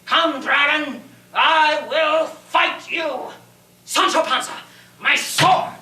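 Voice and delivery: slow, ringing battle challenge; heroic challenge. Acted. Knight challenging the dragon and calling for his sword, heroic shout.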